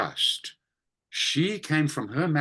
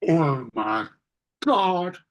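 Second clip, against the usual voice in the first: aghast, swooping high on each outcry; theatrically appalled; 7 semitones higher, much slower than usual. Retold or imitated. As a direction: gasp in hammed-up dismay at a decor crime. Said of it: "He'd look and he'd go".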